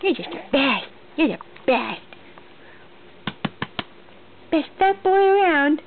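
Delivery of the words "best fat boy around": up high and loud, beaming; high loud praise. Real, not acted. Mother praising her baby, high and loud.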